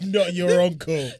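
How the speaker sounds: high, loud, slow punchline; "I'm not your uncle" shouted high and slow; loud mock punchline. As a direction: belt the punchline out.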